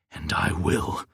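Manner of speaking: low, tight growled reply; ground out. Acted. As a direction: Samuel grinding out a threat, low and tight. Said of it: "Samuel ground out".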